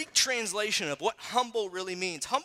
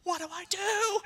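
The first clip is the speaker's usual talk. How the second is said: mock panic; 9 semitones higher than usual. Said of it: "And I'm like".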